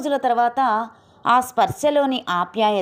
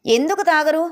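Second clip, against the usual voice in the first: a sharp, higher-pitched snap of a question; snappish retort; 6 semitones higher than usual. A narrator's reading. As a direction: fling the question back curtly. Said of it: "విసురుగా అంటూ". (విసురుగా, చిరాకుగా అడిగిన ప్రశ్న)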